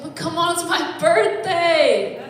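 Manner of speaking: high, slow sing-song whine; whiny plea. Pretend. Comic pleading with his girlfriend in a sing-song whine. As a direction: stretch out the birthday plea. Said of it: "I was like".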